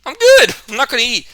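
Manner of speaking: very high squeaky nervous refusal; a high, loud, nervous "no thanks"; quick polite refusal. Retold or imitated. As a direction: back away politely from the plate. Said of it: "and I'm like".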